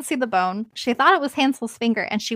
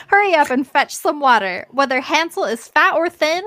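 loud, higher bossy witch; witch shouting orders; 6 semitones higher, 4 dB louder than usual. A narrator's reading. Witch ordering Gretel to fetch water, loud shout. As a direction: bark the order at the girl. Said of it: "she shouted to the girl".

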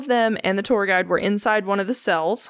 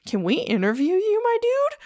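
excited asking; 13 semitones higher than usual. Retold or imitated. Zach asks ex-inmate excitedly.

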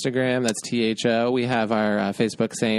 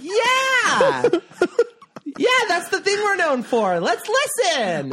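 squeaky, high mock Australian accent with laughing; a silly hammed-up Aussie voice, much higher; Australian impression; 4 dB louder, slower than usual. Mocking Australian listeners with a squeaky hammed-up accent. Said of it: "This is our impression of Australia".

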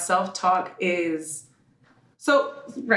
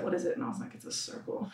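hushed reply; 3 semitones lower, 13 dB quieter than usual. Retold or imitated. Retold exchange with hypnotist.

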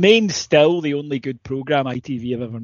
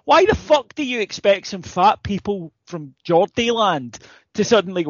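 ordinary talk, nothing marked

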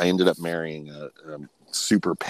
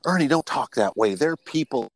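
scolding grandma; 8 semitones higher than usual. Retold or imitated. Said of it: "so she says to him".

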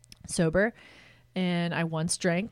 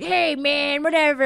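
put-on 1 casual drunk; 8 semitones higher, 8 dB louder than usual. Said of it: "this guy's like".

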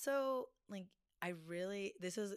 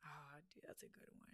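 whisper; 3 semitones lower, 20 dB quieter than usual. Real, not acted. Thinking aloud under breath.

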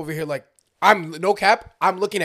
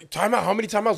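higher-pitched pressing question; retold urgent questioning; 5 semitones higher, 3 dB louder, faster than usual. Kurt pressing the scorer for a timeout, mock urgent. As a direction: imitate someone pestering for an answer.

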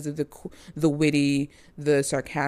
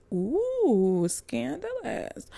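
mock scandalized; 8 semitones higher, 4 dB quieter, much slower than usual. Mock gasp at actress gossip.

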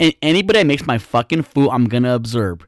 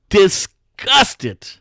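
higher, drawn-out disgust; 6 semitones higher than usual. Retold or imitated. Comic recalls dirty soda cup, disgusted. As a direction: spit the word out in disgust. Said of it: "I got so fucking disgusted".

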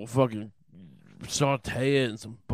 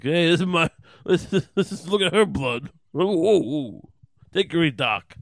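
loud, brash bad Dice impression; hammed-up wise guy with whooping exclamations; Judas Dice Clay; 4 semitones higher, 7 dB louder than usual. Judas shows off to Jesus, loud mock Dice heckle. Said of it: "Judas is like".

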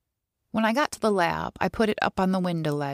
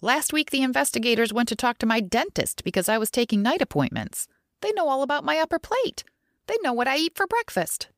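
high-pitched indignant complaint; snappish; 7 semitones higher than usual. A narrator's reading. Coworker complaining about prying investigators, indignant and snappish. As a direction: climb much higher as the grievances pile up. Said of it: "she snapped".

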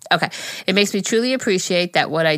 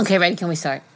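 ordinary delivery

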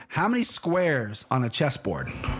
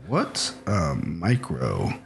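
slow, low, halting read-out; dictating while typing; 8 semitones lower, slower than usual. Real, not acted. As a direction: say each word as you type it, amused. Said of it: "tell me what you're typing as you do it".

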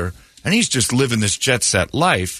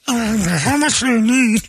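eager housewife; 13 semitones higher than usual. Acted. Housewife offering money.

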